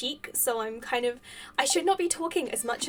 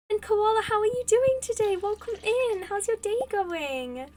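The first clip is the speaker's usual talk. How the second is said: high, loud, singing-out welcome; excited greeting; 8 semitones higher, 5 dB louder than usual. Real, not acted. Streamer welcoming a viewer into the chat, excited greeting. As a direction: call out a big, high hello.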